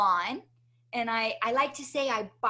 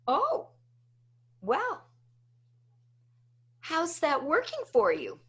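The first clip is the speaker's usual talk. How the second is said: high, sing-song mock concern; unsympathetic mom; 5 semitones higher than usual. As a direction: imitate a mother sweetly turning the problem back, with long pauses. Mother's teasing reply to crying child.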